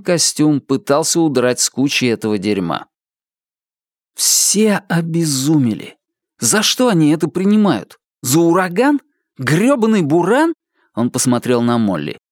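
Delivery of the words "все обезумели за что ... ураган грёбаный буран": higher-pitched, baffled questioning; exasperated disbelief, pitch climbing; incredulous outburst. Acted. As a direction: ask it in rising disbelief. Character exclaims in disbelief at shoppers.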